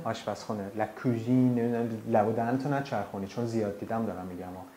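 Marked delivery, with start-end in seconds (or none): none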